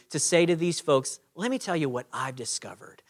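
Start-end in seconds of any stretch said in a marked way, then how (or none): 1.3-3.0 soft confiding whisper; gently sharing a secret with a friend, whispered; gentle whisper. Pretend. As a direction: lean in and whisper it kindly. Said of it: "gently and graciously".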